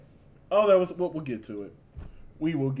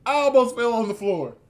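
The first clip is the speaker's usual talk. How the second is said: excited; 6 semitones higher, 7 dB louder than usual. Real, not acted. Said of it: "repeat said bigger".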